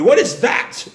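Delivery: high, loud cry of disbelief; shocked. Retold or imitated. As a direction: blurt it out in acted shock. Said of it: "Russo is just shocked".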